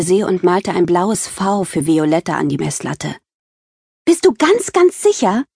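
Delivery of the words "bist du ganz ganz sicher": much higher, eager question; thrilled girl double-checking; excited. Acted. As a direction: bounce with excitement as you ask. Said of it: "Aufgeregt wippte ich auf und ab".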